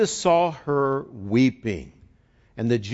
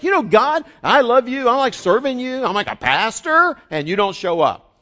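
higher, louder frustrated plea to God; complaining to God; 10 semitones higher, 6 dB louder than usual. Retold or imitated. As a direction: blurt out your grievance to God. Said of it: "kind of like".

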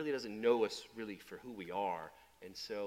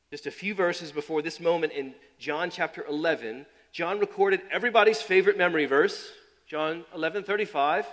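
louder sermon announcement; preaching; 5 semitones higher, 12 dB louder than usual. Acted. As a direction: proclaim the verse reference. Preaching at full force.

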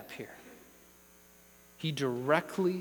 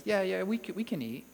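dismissive, shrugged-off acknowledgement; mock offhand; 3 semitones higher than usual. Preacher voicing Eve minimising God's provision, offhand. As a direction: toss it away, trailing down. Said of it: "She doesn't put that in there".

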